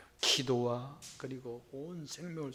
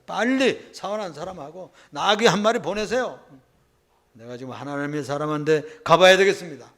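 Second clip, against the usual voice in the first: urgent call; 2 semitones higher, 11 dB louder than usual. Acted. Wife urgently sending for donkey.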